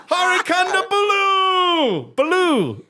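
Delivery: high, drawn-out announcer cry on Kondabulu; John Oliver introduction. Retold or imitated. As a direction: stretch Kondabulu out, ending on a long "looo". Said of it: "introduce him".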